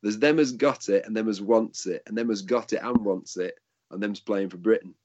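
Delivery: imitating a Yorkshire rugby league player's accent. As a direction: put on a broad Yorkshire accent. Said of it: "I'll put it in his accent".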